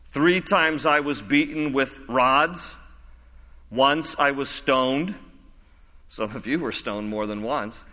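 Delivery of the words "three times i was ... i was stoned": slow reading. Shown as a demonstration. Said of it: "slow dramatic scripture reading".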